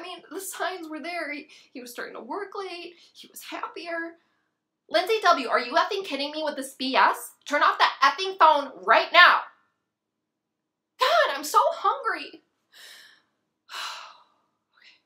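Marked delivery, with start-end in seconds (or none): 4.9-9.4 loud, exasperated snap; furious scolding. Acted. Snapping at a friend to turn off the phone, furious. Snaps at friend texting again. 11.0-12.4 loud, slow, high whine; exasperated outburst. Acted. Worked-up woman complaining to friends of hunger, exasperated.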